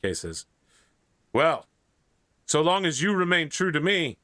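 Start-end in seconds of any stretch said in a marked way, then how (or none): 1.3-4.2 higher-pitched, mildly pompous knight; a hint of lordly self-importance. Kay answers his brother the king, lightly pompous. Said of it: "a sort of pompous voice".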